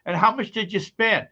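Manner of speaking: lightly voiced suspicious wife; wife asking price. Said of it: "And she'll say".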